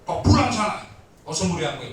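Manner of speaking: loud, rough command from the voice; harsh angry voice. Retold or imitated. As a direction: bark it like an angry spirit. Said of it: "Tapi dia marah, ngomongnya suaranya keras".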